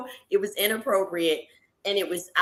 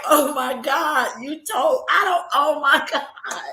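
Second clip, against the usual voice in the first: mock shock; 6 semitones higher, 4 dB louder, slower than usual. Shocked at what he said.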